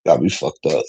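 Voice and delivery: low, scoffing brush-off; low dismissive pfft and retort. Retold or imitated. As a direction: scoff and wave it away, low and quiet. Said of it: "I said".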